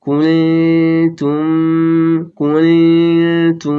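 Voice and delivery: কুলতুম with the final m hummed through the nose, slow; কুলতুম drawn out with a nasal m; ikhfa shafawi. Shown as a demonstration. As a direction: hold the m of কুলতুম as a long nasal hum. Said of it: "এভাবে।".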